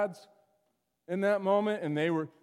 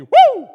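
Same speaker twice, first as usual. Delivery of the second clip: loud, high-pitched "woo"; kids' running whoop; 20 dB louder than usual. Retold or imitated. Preacher imitating kids who run through during his sermon, high whoop. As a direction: whoop like a kid tearing through the room. Acting out children randomly running by.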